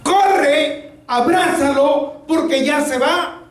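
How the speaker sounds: high, insistent call with long vowels; urgent voice summoning him; commanding voice. Retold or imitated. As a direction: cry out the order, high and pressing. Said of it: "la voz que me dice".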